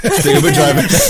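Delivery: high, loud laughing banter; cracking up over Uber drivers. Real, not acted. Friends crack up remembering Uber drivers, loud laughter.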